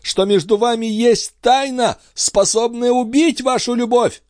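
high, pained agitation; agitated. A narrator's reading. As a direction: work yourself up through the pain. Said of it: "приходя всё в большее возбуждение от собственной боли". (взволнованно, высоким голосом)

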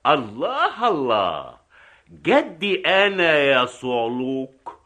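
high, drawn-out indignant rebuke; outraged. Acted. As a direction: swell with offended pride. Outraged at insult to his grandfather. (غاضبًا مستنكرًا بصوت عالٍ)

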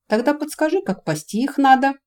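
exasperated, high-pitched request; pleading sigh. A narrator's reading. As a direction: ask for advice with a helpless sigh. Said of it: "вздохнул Корвин".

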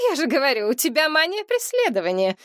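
high, mocking tone. A narrator's reading. Mother mocking her daughter's suspicion, high teasing. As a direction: tease her with a smirk in the voice. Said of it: "насмешливо проговорила мать".

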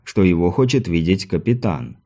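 plain reading voice

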